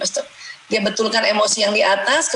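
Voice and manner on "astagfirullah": breathy whisper of the prayer, voice gone; a hushed istighfar fading to nothing. Shown as a demonstration. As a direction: let the repeat of the prayer fall away into a whisper. Demonstrating a softer repeat of istighfar.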